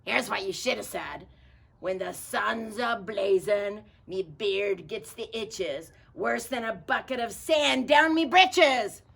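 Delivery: loud slow pirate rhyme; hollering pirate. Acted. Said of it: "Pete hollered".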